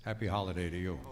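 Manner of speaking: ordinary delivery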